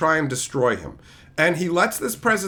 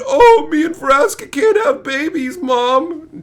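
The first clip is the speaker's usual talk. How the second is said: mock sad; 8 semitones higher, 6 dB louder, slower than usual. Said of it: "where it sadly said".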